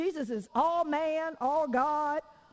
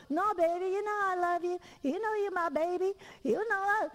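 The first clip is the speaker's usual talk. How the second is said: sweet-talking her son; 7 semitones higher than usual. Retold or imitated. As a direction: coax him gently, a little higher. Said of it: "I was like".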